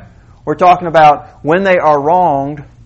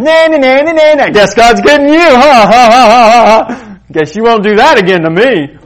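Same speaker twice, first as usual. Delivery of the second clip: high, loud sing-song gloat with mock laughter; gloating taunt; 7 semitones higher, 9 dB louder than usual. Believer gloats over a fallen enemy with loud, taunting mock laughter. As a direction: rub it in like a schoolyard bully. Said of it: "this saying".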